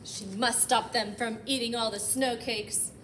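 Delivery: ordinary delivery, nothing marked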